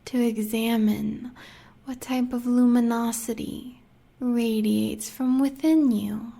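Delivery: usual speaking voice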